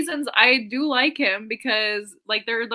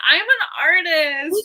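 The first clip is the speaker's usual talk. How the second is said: mock pretentious; 6 semitones higher, 6 dB louder than usual. Mocking Clary.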